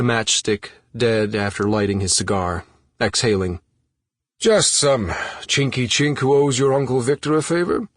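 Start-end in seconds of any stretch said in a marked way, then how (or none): 4.4-7.9 smug mid-Atlantic boast; mid-Atlantic accent. A narrator's reading. Victor answering Jane about the island, lightly voiced mid-Atlantic accent. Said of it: "in that unplaceable mid-Atlantic accent".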